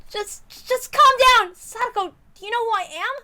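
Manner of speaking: high, loud, urgent call to calm down; urgent plea. Acted. Keiichi calling out to panicked Sadako, high urgent plea. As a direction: raise your voice to reach her panic.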